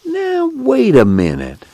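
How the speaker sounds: high, slow soothing protest; calm her down, drawn out; placating. Acted. Al trying to stop the angry girl, high and placating.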